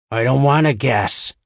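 growled. A narrator's reading. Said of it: "Marjorie growled".

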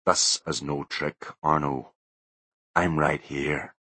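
low, soft and slow, like a spirit; haunt him calmly; low slow ghostly voice. A narrator's reading. Ghostly Butler voice.

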